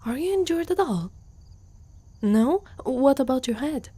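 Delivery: gasp, then a high anxious question; worried gasp. Acted. Centaur suddenly worries the stranger is hurt, gasping. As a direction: catch your breath with sudden concern.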